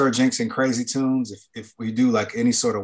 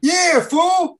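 high mock shout; 7 dB louder than usual.

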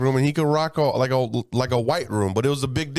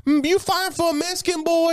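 flirty woman voice. Retold or imitated. Fans at one table cooing at the comic, high. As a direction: coo it like a flirty fan. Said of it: "They're like".

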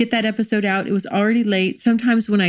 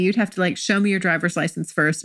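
usual speaking voice

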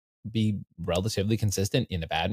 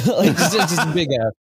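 laughing; 8 semitones higher, 7 dB louder than usual. Real, not acted. Laughing at forced counter.

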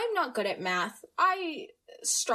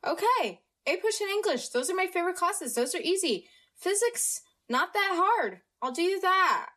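higher-pitched, breezy imitation of a confident student; eager self-talk; 7 semitones higher than usual. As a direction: rattle it off as easy, pitched up. Acting out eager student's reaction.

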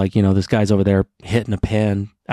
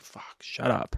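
muttered brush-off; 3 semitones higher, 12 dB quieter, slower than usual. Retold or imitated. Brushing off the stoner.

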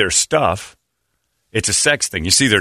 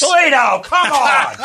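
very high, loud call; exasperated shout; 5 dB louder, slower than usual. Real, not acted. Host calling a missing colleague, high exasperated shout. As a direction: yell for someone out of the room.

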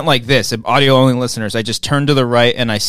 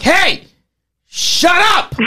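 put-on much higher, shouted hey, shut up at chatty teens; much slower than usual. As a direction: yell it over your shoulder at the talkers. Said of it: "I was like".

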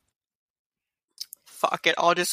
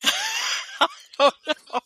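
shrieking with laughter; laughing shout; much slower than usual. Real, not acted. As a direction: laugh it out, squealing high. Bursts out laughing.

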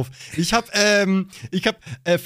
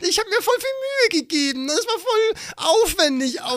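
hammed-up teary complaint; mock hurt whine; 11 semitones higher than usual. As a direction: play the misunderstood artist close to tears, half joking. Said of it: "ich hatte ganz kurz so richtig Tränen in den Augen".

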